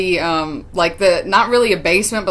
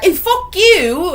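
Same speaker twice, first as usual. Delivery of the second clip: loud, high, drawn-out British-style retort; hammed-up British comeback, slow and high; botched British accent; 12 semitones higher, 5 dB louder, slower than usual. Girl yelling back at the old man, botched British accent. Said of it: "that was a British accent".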